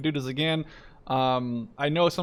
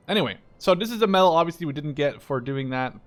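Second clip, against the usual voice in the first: normal talking voice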